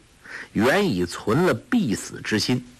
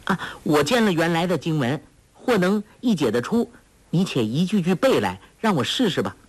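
put-on higher girlish voice, coaxing; clever girl, playing along; 5 semitones higher, faster than usual. Girl humouring the villain to buy time, coaxingly. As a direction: pitch it up as a girl stalling for time.

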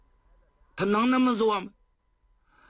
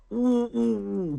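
slow, high drawn-out sobbing; sobbing wail; 9 semitones higher, slower than usual. Acted. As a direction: weep without words. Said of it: "光哭了". (呜呜地哭)

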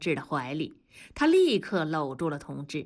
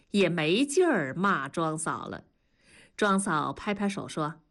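ordinary delivery, nothing marked

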